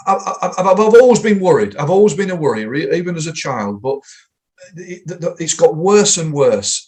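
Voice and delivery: nervous, halting, stammering; anxious stammer. Retold or imitated. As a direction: stumble through it nervously. Quoting anxious client.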